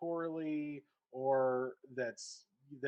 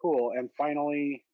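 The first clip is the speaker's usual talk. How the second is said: muffled headset mic; 9 dB louder than usual. Shown as a demonstration. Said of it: "A bit muffled".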